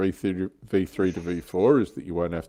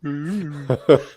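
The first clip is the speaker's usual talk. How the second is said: laughing; 8 semitones higher, 4 dB louder than usual. Real, not acted.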